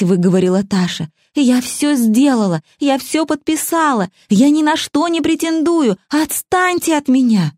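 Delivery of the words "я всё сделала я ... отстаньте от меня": desperate pleading. A narrator's reading.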